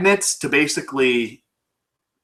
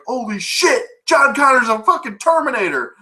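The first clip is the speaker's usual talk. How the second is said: panicked shout; 8 semitones higher than usual. Retold or imitated. Heroes panicking in the car.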